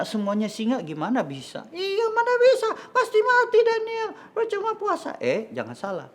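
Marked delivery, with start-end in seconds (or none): 1.7-5.2 very high squeaky puppet voice; doubting little puppet. Acted. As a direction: scoff in a tiny high-pitched voice.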